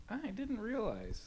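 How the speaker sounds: high, soft little "oh" of realization; imitated innocent surprise, high and quiet; mock surprised realization. Voicing someone's quiet surprised realization.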